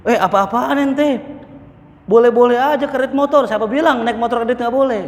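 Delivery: high, indignant retort, half joking; mock angry ustadz. Preacher mimicking an ustadz angry at his Facebook comment, high mock indignation. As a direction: play the offended cleric snapping back, hammed-up. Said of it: "Itu Ustadz marah".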